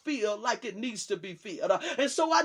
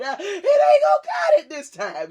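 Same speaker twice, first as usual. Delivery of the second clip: much higher and louder cry of warning; shouting climax; 8 semitones higher, 9 dB louder than usual. Real, not acted. Preacher warning the church that half won't do, shouting. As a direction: hammer it home at the top of the voice.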